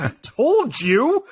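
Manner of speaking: high, loud and slow through a laugh; a laughing, drawn-out told-you. Retold or imitated. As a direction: gloat through laughter at the daughter. Teasing daughter in theater.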